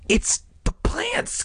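very high, drawn-out, halting fear; frightened. A narrator's reading. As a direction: squeak it out slowly in fright. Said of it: "his expression frightened now".

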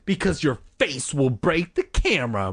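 usual speaking voice

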